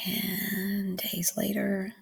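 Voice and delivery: slow hushed whisper. Real, not acted. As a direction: breathe it out slowly. Aside while waiting for upload.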